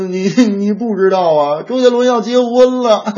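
hammed-up tearful wail with sniffles; mock crying. Sister wailing to brother that her idol is marrying, mock crying. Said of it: "我妹妹一个人在屋子里头哭".

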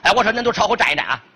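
lightly voiced Henan accent, brisk; Henan dialect. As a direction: tell the guards to step back in Henan dialect. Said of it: "就得说河南话呀".

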